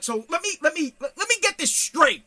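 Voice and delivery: loud, high, disbelieving interrogation; incredulous, rising exasperation; pissed-off dad. Retold or imitated. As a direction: re-enact grilling your kid, punching key words. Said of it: "I got pissed. I was like".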